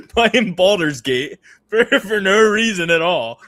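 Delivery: high, loud, laughing through the words; real laughter breaking into slow words. Real, not acted. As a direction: crack up while confessing. Laughing at own excuse.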